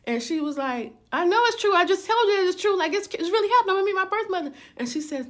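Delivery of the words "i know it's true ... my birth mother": much higher and louder, fast; exasperated, bursting; excited insisting. Retold or imitated. Daughter insisting to her friend, excited and fast.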